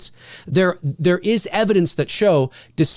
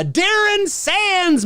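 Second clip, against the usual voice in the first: drawn-out shoutout; 14 semitones higher, much slower than usual. Acted. Reading chat name with flourish.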